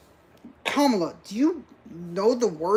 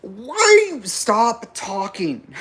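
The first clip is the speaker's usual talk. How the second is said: slow, loud, higher cry of disbelief; pleading yell of disbelief; exasperated shout; 6 semitones higher, 5 dB louder, slower than usual. Real, not acted. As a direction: beg her to stop, loud and drawn out.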